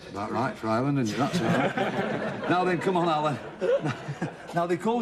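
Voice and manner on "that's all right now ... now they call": chuckling through the words; laughing. Real, not acted. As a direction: still laughing as he moves on. Host laughing after contestant's joke.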